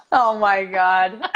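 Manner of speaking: slow, drawn out through laughter; laughing. Real, not acted. Daughter reacting to mother's joke, laughing. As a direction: groan it while laughing.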